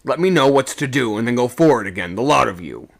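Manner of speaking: firm, loud command; officer taking charge; sharp order. A narrator's reading. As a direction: order the men away sharply.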